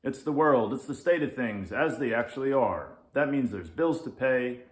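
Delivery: loud, gruff lecturing cat; grumpy lynx. Acted. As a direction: bark out the facts of life. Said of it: "says the Grumpy Lynx".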